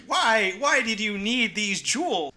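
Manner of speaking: hammed-up cartoon sleuth interrogation; questioning Dinkley. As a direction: press the culprit for a motive. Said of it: "says Dinkley".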